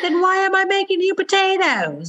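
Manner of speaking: high mock Monica complaint; imitating Monica. Monica complaining about cooking for everyone, high mock voice. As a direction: pitch it up, put-upon.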